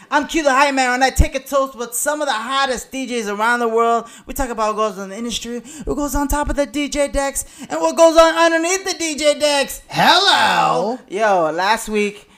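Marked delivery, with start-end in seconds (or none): 0.1-11.0 loud, high-energy show opener; hype man intro. Acted. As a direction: hype up the crowd like an MC.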